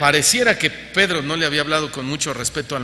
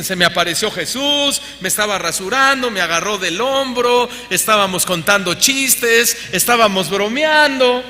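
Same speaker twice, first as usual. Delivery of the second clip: rising, louder mock of a boastful believer; mocking imitation; 5 semitones higher, 4 dB louder than usual. Preacher parodies believers boasting of casual visions. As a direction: parody those who claim casual chats with Jesus. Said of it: "Hoy, hoy muchos dicen".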